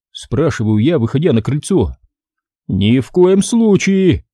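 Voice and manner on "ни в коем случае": slow, higher refusal with disgust; dad refuses, slowly and disgusted. A narrator's reading. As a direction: recoil from the idea. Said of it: "с отвращением говорит он".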